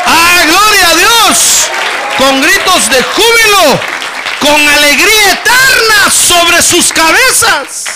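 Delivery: high, loud, ringing scripture proclamation; fiery preaching. Acted. Pastor reads the promise to the congregation in full preaching voice. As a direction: lift the verse up over the crowd.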